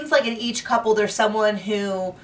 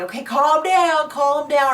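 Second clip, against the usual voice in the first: soothing calm down; 7 semitones higher, 3 dB louder than usual. Retold or imitated. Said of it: "kind of are like".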